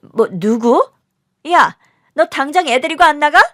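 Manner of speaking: high, loud scolding; teacher's angry outburst. Acted. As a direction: order the pupil out at once. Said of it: "선생님의 불호령이 떨어졌고". (학생을 내쫓는 선생님의 호통)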